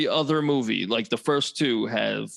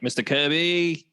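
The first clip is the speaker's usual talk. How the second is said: imitated New Zealand accent, pitched up; 4 semitones higher than usual. Quoting the actor's slip, New Zealand accent. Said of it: "I only noticed it when he says".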